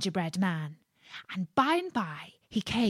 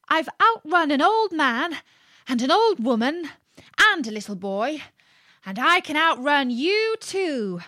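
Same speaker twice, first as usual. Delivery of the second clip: higher, louder cheeky brag; cocky little cookie voice; boastful gingerbread man; 7 semitones higher, 4 dB louder than usual. Acted. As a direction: crow about being uncatchable.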